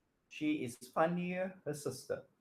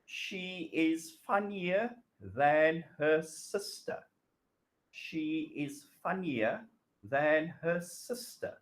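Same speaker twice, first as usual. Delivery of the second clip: slow model sentence; slower than usual. Shown as a demonstration. Teacher models the corrected sentence for students, slow. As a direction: say she is funnier than her sister slowly and clearly. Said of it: "So the correct writing is".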